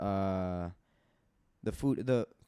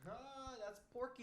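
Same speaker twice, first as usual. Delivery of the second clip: cooing; 12 semitones higher, 14 dB quieter than usual. Real, not acted. Cooing over an imagined pet pig's name.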